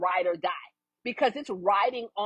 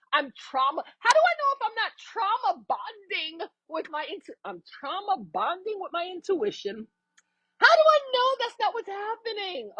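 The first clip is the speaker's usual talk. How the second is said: much higher, whiny worried voice, getting shriller; anxious doubting self; 7 semitones higher than usual. Pretend. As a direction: play the panicked overthinker spiralling. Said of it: "sometimes we're like".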